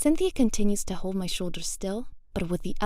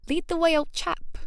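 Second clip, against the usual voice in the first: high-pitched mock British; playful, hammed-up British toff talk; rubbish British accent; 7 semitones higher than usual. A narrator's reading. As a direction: badly fake a chummy British accent. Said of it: "doing a rubbish British accent".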